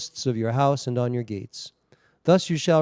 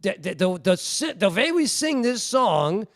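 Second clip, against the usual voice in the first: light imitation of an old Yiddish accent, higher; 10 semitones higher, 3 dB louder than usual. Retelling the rabbi's complaint about the dirge, in a light Yiddish accent. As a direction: mimic a rabbi's broken Yiddish English, mildly. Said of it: "in his old Yiddish accent".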